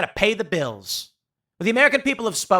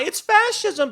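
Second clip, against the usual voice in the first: much higher hammed-up shriek; mock scream; 13 semitones higher, slower than usual. Critics crying about Trump, higher mock shriek. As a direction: shriek it like a panicked protester. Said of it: "scream and cry".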